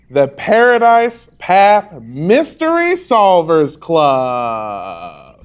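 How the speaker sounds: slow, drawn-out club title reveal; grand announcement. Acted. Game master unveils the club's name, grandly announced. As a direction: announce it big, stretching the last word long.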